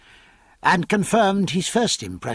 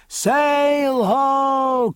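shouting from crow's nest; 10 semitones higher than usual. Acted. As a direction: call it out from the masthead. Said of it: "cried the lookout".

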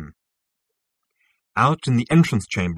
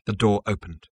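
ordinary delivery, nothing marked

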